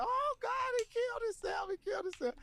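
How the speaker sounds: frantic high-pitched mock wail; panicked mother. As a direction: shriek it like a mother finding her son. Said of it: "my mother was saying".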